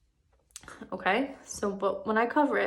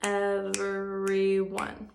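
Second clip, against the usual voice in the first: very slow, each syllable split apart; clapped syllables; much slower than usual. Shown as a demonstration. Teacher clapping syllables of everyone for first graders, slow and split. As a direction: clap out every syllable slowly. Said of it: "snap the syllables".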